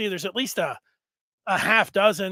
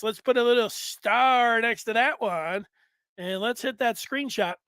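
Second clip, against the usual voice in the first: ordinary talk, nothing marked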